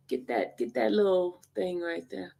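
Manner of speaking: ordinary talk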